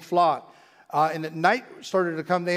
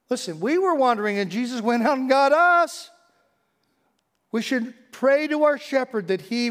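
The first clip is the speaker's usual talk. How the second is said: higher, louder preaching; fervent preaching; 7 semitones higher, 4 dB louder than usual. Real, not acted. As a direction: proclaim it with fervor. Preaching at full force.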